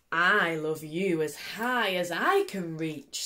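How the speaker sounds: a calm father answering, unhurried; big hare voice. Acted. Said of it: "said big nut brown hare".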